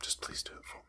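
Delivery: breathy mock-desperate whisper; whispered plea. Friend begging Oren to take the title, whispered. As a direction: beg in a hushed whisper.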